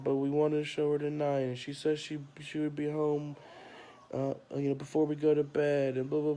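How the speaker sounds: a hint of a small boy who won't let it go; little son protesting. Boy protesting to dad about Mom's promise, lightly voiced kid.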